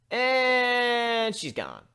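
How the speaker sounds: long amused drawl; drawn out. Acted. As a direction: let the words hang as she keels over. Pony faints from shock.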